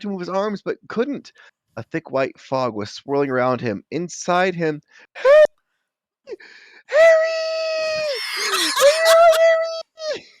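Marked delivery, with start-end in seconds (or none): 5.1-10.3 very high, loud shout of Harry; calling out. Acted. Friends trying to wake Harry, shouting his name. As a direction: yell to wake him up.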